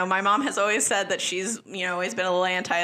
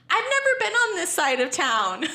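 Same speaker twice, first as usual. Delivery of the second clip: high-pitched movie impression; quoted movie line; 9 semitones higher than usual. She imitates the character out of place in a punk bar, high-pitched quote. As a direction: put it up high, quoting the character. Quoting actress in bar scene.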